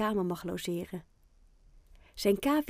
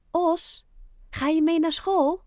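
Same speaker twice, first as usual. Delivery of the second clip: called up the stairwell, much higher and louder; mother calling upstairs; 9 semitones higher, 6 dB louder than usual. Acted. Mother calls Oscar up the stairwell, raised higher calling voice. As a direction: mum calling her son down from below. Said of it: "roept zijn moeder door het trapgat".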